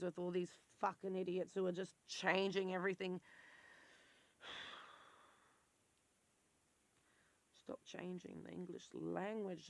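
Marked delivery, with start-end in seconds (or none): 4.4-9.7 exasperated sigh. Real, not acted.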